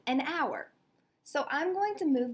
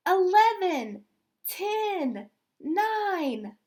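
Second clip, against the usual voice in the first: higher, louder chanted count; crowd yell; 6 semitones higher, 5 dB louder, much slower than usual. Acted. Crowd yelling the last seconds, a loud high countdown. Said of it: "the crowd continued to yell".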